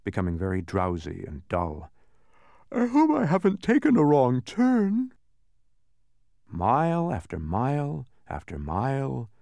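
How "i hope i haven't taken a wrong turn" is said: put-on high, loud young boy voice; boyish voice. A narrator's reading. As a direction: voice Milo as a worried boy. Milo speaking in story.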